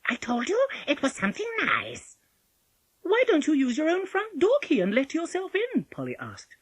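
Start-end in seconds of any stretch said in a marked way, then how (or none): 0.1-2.2 high, peeved wolf in disguise; false voice. A narrator's reading. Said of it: "still in his false voice". 3.0-5.9 put-on high, loud child's voice; Polly, testing. A narrator's reading.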